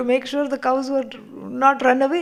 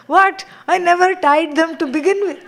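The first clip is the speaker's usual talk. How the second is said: much higher and louder, fast astonished outburst; shocked disbelief; 7 semitones higher, 5 dB louder than usual. Acted. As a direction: blurt it out in total surprise. Student shocked by guru's question.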